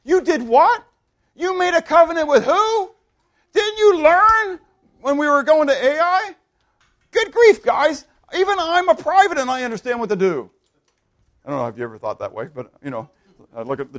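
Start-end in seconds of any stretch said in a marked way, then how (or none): 0.0-10.5 high, loud disbelief; incredulous outburst. Acted. Private scolding the generals over their covenant, incredulous. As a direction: berate them in high-pitched astonishment. Acted incredulous rebuke of leaders.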